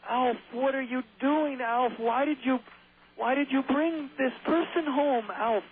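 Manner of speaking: very high, slow exasperated sitcom dad; Willie. Retold or imitated. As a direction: scold the houseguest in a high mimicking voice. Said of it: "Willie, Willie from Alf".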